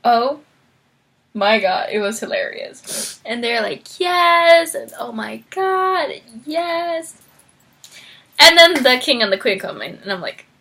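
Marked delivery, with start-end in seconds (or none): 4.0-7.2 high, drawn-out delighted yes; imitating an overjoyed couple; pitched-up happy squeal; mock elation. Voicing the happy couple's acceptance.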